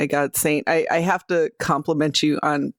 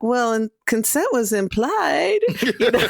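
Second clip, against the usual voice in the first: lightly acted, higher-pitched excuse; mock seventies justification; 6 semitones higher than usual. Player voicing Maria's seventies outlook on consent, mock justifying. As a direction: lift it into a playful, hedging excuse. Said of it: "the seventies, it was kinda like".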